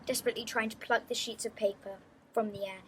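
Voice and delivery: ordinary delivery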